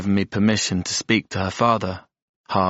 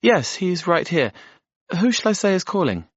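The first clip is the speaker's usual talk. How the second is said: much higher, bright office greeting; receptionist voice; 12 semitones higher than usual. Acted. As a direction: answer the phone as a polite secretary. Receptionist on the phone.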